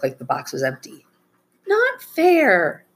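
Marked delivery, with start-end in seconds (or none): 1.7-2.8 put-on high-pitched kid whine; whining. A narrator's reading. Sister protests her brother's trick, high whine. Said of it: "she whined".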